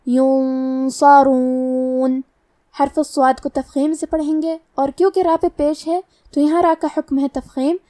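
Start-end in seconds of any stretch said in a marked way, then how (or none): 0.1-2.2 "ینصرون" chanted very slowly with a heavy nasal hum on the noon; drawn-out tajweed "ینصرون" with dark hum; heavy ghunnah. Shown as a demonstration. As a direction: hold a full, heavy hum before the S in "ینصرون". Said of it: "غنہ کو بھاری آواز میں".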